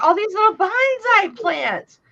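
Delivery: high, slow bewildered tone; mock puzzled self. Aunt retelling her bonsai mistake, high bewildered tone. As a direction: play up how clueless she was. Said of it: "I'm like".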